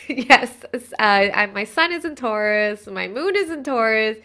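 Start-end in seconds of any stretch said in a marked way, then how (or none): 0.1-0.5 a laughing "Yes". Real, not acted. Laughing at own confusion.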